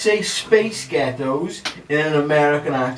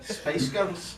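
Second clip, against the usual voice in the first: Scottish-sounding American attempt; 5 semitones higher, 9 dB quieter than usual. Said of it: "You just said it in a Scottish accent".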